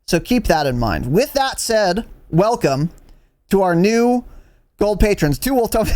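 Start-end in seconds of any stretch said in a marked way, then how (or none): 2.3-5.4 measured announcement. Real, not acted. Announcing names, before the restart. 5.4-5.9 garbled speed-read as a joke; higher, hurried, about to crack up; rushed name-reading. Real, not acted. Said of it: "I wanted to read 'em all fast".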